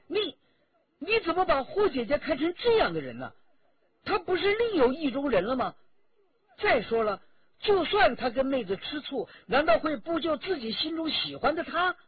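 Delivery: high, loud, rapid-fire protest; indignant outburst. A narrator's reading. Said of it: "周启却跳了起来". (又高又急地愤愤反驳)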